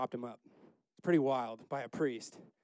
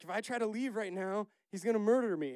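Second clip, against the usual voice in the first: high-pitched protest, getting louder; mock worried protest; 8 semitones higher, 5 dB louder than usual. Preacher voicing Samuel objecting to God, mock worried protest. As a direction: play up the alarm, high, building louder.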